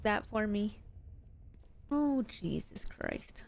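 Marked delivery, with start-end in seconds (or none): none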